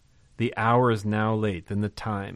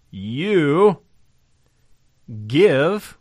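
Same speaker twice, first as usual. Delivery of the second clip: "You" and "give" both called out high and loud; "You" hit hard, then "give" rising and loud; stressed You and give; 5 semitones higher, 9 dB louder than usual. Acted. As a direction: punch "You give" as Jesus' command. Jesus' reply stressed in sermon.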